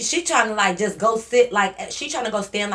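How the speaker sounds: ordinary talk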